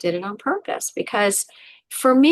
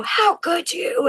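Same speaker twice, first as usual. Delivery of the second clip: scolding accusation; 8 semitones higher, slower than usual. Retold or imitated. Head scolding her.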